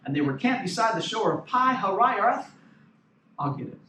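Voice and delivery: normal talking voice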